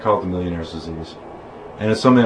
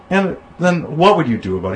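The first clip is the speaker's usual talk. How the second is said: higher-pitched, patronizing question; slightly condescending; 8 semitones higher, 3 dB louder than usual. Retold or imitated. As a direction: imitate a host talking down a little. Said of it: "a little bit condescending".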